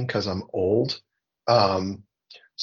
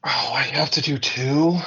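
breathy whisper, caught off guard; whispered surprise; 4 semitones higher than usual. Real, not acted. Panelist caught off guard, whispering back to the host. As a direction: whisper it like you just realised you owe two answers. Surprised at needing two picks.

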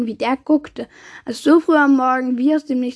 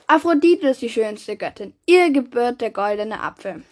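plain reading voice

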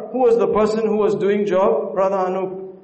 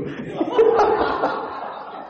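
high laugh; 14 semitones higher, 3 dB quieter than usual. Real, not acted. Preacher laughs at own joke.

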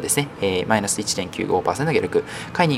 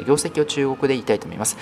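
ordinary talk

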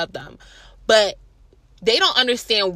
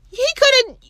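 higher, outraged realization; indignant realization; 4 dB louder than usual. Real, not acted. To the audience, realizing he planned it, indignant and higher. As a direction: say it with rising outrage.

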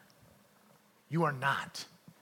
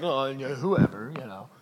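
mock casual, lower and dropping away; offhand teenager reply; 3 semitones lower, 4 dB louder than usual. Kid answering mom about the party, offhand. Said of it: "when your mom asks you".

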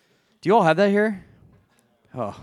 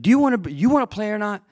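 higher, louder mock coach demanding; angry coach; 7 semitones higher, 3 dB louder, faster than usual. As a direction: badger the kid like a riled coach. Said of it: "I'm not gonna do the accent".

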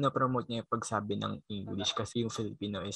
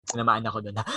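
normal talking voice

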